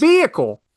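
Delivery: high, emphatic "vehicle" as VEE-ick-ul; silent H. Shown as a demonstration. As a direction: say "vehicle" firmly with no H, stress up front. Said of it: "You don't need that emphasis".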